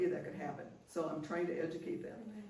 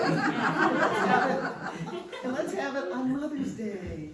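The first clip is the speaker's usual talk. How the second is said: much higher and louder, stretched slow through laughter; drawn-out laughing; 7 semitones higher, 9 dB louder, much slower than usual. Retold or imitated. Mother setting the family talk for Mother's Day, stretched laughing. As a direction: drag it out while laughing. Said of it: "I said".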